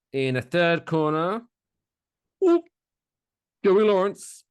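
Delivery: big fight-night introduction; ring announcer. Acted. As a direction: drag out the names like a ring announcer.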